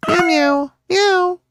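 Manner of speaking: light playful meows; kitty meows. Acted. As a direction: chatter like a kitten to its mom. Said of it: "The kitties are talking to their mom".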